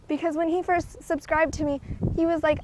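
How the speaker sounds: ordinary talk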